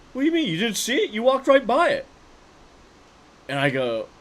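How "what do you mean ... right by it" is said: high, loud, thrilled incredulity; he can't believe you missed it; excited disbelief. Retold or imitated. Friend amazed his buddy walked past his new car, excited. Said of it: "And he's all excited".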